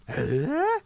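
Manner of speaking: ordinary talk, nothing marked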